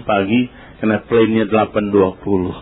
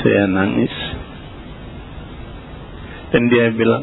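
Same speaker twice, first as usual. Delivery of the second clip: ordinary delivery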